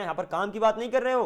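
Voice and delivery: usual speaking voice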